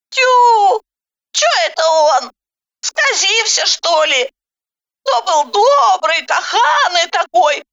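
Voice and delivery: high, amazed village granny voice; indignant old granny. A narrator's reading. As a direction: grumble and marvel in a high old woman's voice. Granny character, astonished. (изумлённая ворчливая бабка)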